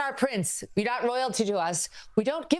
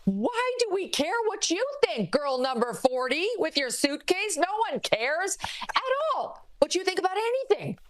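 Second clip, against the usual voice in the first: mocking high voice; 8 semitones higher than usual. Mocking the prince's critics.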